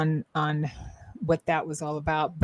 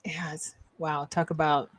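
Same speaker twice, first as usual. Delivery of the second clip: ordinary delivery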